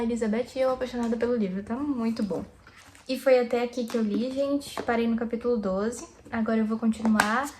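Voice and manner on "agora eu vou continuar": ordinary delivery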